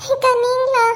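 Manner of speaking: high mock sob; fake crying. Greeting an arriving neighbour, fake crying. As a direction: put on tears between the words. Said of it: "அழுத மாதிரி இருக்கேன்".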